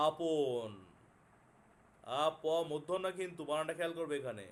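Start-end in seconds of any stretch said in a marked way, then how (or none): 0.1-0.7 drawn-out word for note-taking; dictating slowly. Real, not acted. Teacher dictating the second homophone to students, slowly drawn out. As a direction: stretch the word out slowly for writers.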